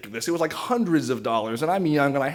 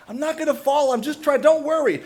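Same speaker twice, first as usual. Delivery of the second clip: high-pitched mock yell in reply; retold yell back; 13 semitones higher than usual. Hanging man answers worried neighbor, mock yelling back. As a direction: act out yelling back, much higher. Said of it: "And I yell back".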